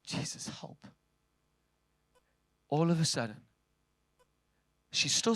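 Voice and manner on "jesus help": breathy whisper of "Jesus, help"; whispered plea to Jesus; whispered prayer. Retold or imitated. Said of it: "your best prayer is".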